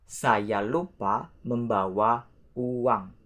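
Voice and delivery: drawn out, word by word; careful example with gaps between words; slow model sentence. Shown as a demonstration. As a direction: say it slowly for learners. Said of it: "Let's say the example".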